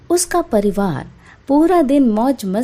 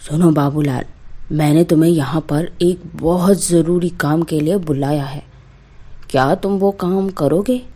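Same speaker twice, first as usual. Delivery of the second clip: a lightly deeper, commanding landlord voice; stern zamindar; 5 semitones lower than usual. Acted. Zamindar summoning Babulal for an urgent job, lightly deeper commanding voice. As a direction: speak down to the painter like a village landlord.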